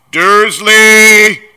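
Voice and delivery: huge, high, drawn-out bellow; booming shout. A narrator's reading. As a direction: boom the name in fury. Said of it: "he boomed".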